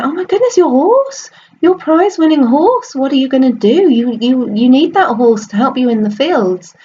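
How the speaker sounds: high, flustered, worried voice; a fussing neighbour panicking over the horse; alarmed neighbour. Acted. Neighbour frets to the farmer about his lost horse, high and worried.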